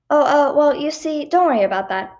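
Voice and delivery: stall, then try to reassure; mildly flustered calming-down; uneasy reassurance. A narrator's reading. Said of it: "trying my hardest to keep her from panicking".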